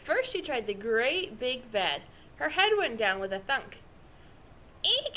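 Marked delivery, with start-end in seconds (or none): 4.8-5.2 high squeak of alarm; a startled eek, pitched high. A narrator's reading. Goldilocks bumps her head on the bed, a high eek. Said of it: "she said".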